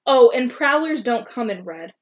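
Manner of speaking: ordinary delivery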